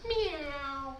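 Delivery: soft, hushed meow; cat meow. Acted. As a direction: mew softly like a kitten. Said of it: "A cat?".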